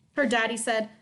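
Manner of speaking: usual speaking voice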